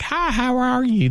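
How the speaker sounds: lilting high greeting, mocking the accent; high mock Oklahoma voice. Mocking a gorgeous man who sounds like this. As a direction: chirp the hello up high. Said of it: "is like".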